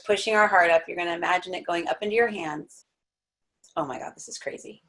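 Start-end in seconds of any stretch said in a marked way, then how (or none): 3.8-4.8 hushed, breathless and close to crying; whispered in awe as tears come; near tears. Real, not acted. Overwhelmed whisper while guiding the class. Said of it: "I'm gonna cry".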